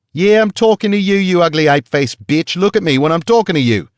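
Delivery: screaming abuse. A narrator's reading. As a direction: scream down at her in an Australian accent. Guard screaming at cowering woman.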